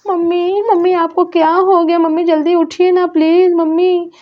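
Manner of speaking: put-on worried little girl calling for mummy. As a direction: play a small girl looking for her mother. Child Aarna pleads.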